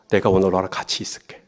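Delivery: soft, low, tender promise; gentle 예수. Acted. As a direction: quietly reassure him. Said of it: "예수님이 삭개오에게 뭐라 그래요". (나직하고 다정하게 약속하는 말투)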